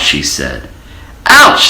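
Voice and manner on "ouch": squeaky high yelp of pain; a well hit by a penny; high ouch. A narrator's reading. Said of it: "said the well".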